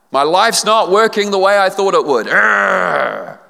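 mock frustrated sigh-groan. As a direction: vent like someone fed up with life. Voicing a frustrated churchgoer.

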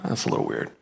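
breathy mock puzzlement; whispered aside. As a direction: mutter it as a baffled listener. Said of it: "We would be like".

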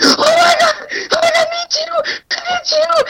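very high, squealing overjoyed cry; ecstatic boyfriend. Retold or imitated. Retelling the boyfriend's overjoyed reply, squealing high. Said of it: "And he was like".

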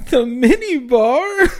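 slow, laughing disbelief. Real, not acted. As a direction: giggle through the question.

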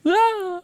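high yelp of surprise; distant yelp. Acted. Zoff yelps as he is carried into the sky, high cry. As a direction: let out a high startled cry. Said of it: "You hear Zoff go".

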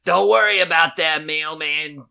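loud brash baker. Retold or imitated. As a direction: grab the parcel with a loud cheerful bark. Baker intercepts mailman loudly.

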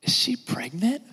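slow, hushed secretive question. Retold or imitated. Gossip leaning in to ask about pregnancy, hushed and slow. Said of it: "Another question that we ask is this".

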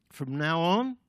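loud mock-important announcement, rising at the end; boastful echo. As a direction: parade the new title to your mates. Peter boasts, echoing Jesus.

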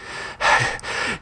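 winded panting breath; audible puff of exertion; heavy breathing. A narrator's reading. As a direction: breathe hard after running. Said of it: "still breathing heavily".

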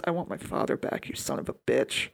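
breathy, voiceless hiss of a line; hushed quote. Retold or imitated. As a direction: hiss the vengeance line under your breath. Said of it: "And he goes".

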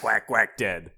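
ordinary talk, nothing marked

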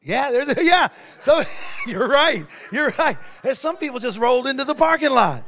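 loud, laughing talk; laughing loudly. Real, not acted. Preacher laughing at his own joke to the church. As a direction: laugh through the words.

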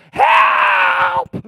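high, loud mock "Help!"; mock cry for help. Pastor acting the poor man crying out to God, a loud mock yell. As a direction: shout "Help!" like a desperate man. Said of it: "It was".

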